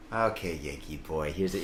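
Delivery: low, quiet bartender voice; bartender imitation. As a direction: play the bartender, dropped low and soft. Imitates bar staff serving him.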